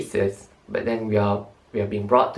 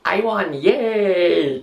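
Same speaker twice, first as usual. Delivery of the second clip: high cheer of victory; excited; 8 semitones higher, 4 dB louder, much slower than usual. Real, not acted. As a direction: cheer it out, thrilled. Celebrating the win.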